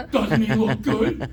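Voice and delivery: slow, laughing through the words; laughing mock wife. Husband imitates his wife admiring his corpse, slow and laughing. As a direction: laugh while showing off the dead husband. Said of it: "my wife's gonna go".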